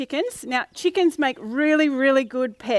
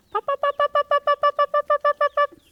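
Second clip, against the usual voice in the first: high clucking hen imitation; 13 semitones higher than usual. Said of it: "She makes a very different noise".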